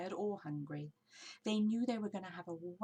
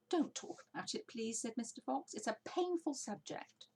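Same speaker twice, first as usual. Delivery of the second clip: higher, mock-wounded plea; pained; 7 semitones higher than usual. A narrator's reading. Mister Fox dodges talk of his lost tail, pained. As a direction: wave the subject away.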